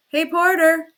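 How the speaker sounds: loud high call across the gateway. A narrator's reading. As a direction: shout for the porter. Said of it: "he cried in the gateway".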